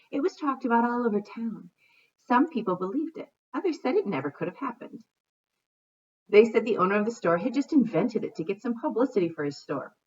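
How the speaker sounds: storybook narration; story reading. A narrator's reading. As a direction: read to children at an even pace. Reads a storybook aloud.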